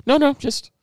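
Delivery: high, chirpy imitation of the clerk; high cheerful saleslady voice. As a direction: pitch it up like an eager helpful clerk. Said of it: "And she goes".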